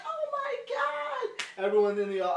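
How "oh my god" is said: very high, drawn-out shriek; hammed-up panic; mock panicked scream. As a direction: scream like a terrified theatregoer. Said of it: "You're like".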